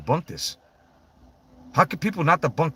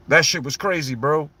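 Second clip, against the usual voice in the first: normal talking voice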